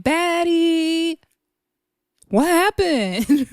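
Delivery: high, slow sing-song question; imitating Natalie. Mocking Natalie outside the hotel, high slow sing-song. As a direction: draw out the words, clueless and sweet. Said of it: "Natalie in the middle of saying".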